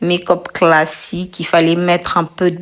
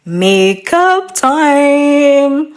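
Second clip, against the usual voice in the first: high, very slow announcement; announcer voice; 8 semitones higher, 3 dB louder, much slower than usual. Acted. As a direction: sing it out like a show host. Announcing makeup segment.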